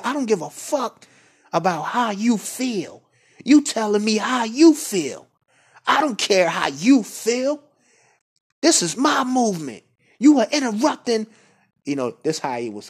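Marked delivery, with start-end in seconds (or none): 0.0-11.3 much higher and louder, saucy furious tirade; aggressive godfather. Retold or imitated. Leader berating a member on the conference call, aggressive yell. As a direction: lay into him like a godfather scolding a minion. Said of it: "talking like he was a godfather".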